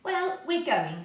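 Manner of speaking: slow, loud and determined; boy refusing to be warned off; firmly. A narrator's reading. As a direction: set your jaw and state it. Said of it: "said Joe firmly".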